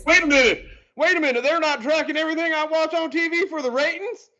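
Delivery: high, loud mock country accent. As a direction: play an astonished viewer with a country accent. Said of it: "I didn't mean to put it in a country accent".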